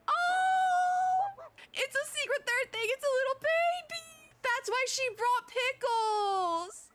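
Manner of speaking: high, tearful squeal, stretching the words out; gushing squeal. Real, not acted. Streamer squealing to chat over the baby dog, tearful and high. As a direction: melt over a tiny puppy.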